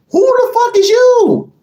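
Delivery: yelled high and loud in real anger; furious shout. Real, not acted. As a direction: blast it at them, pitch way up. Angry rant about Muslims.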